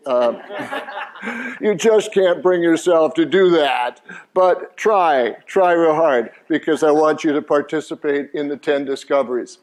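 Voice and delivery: ordinary delivery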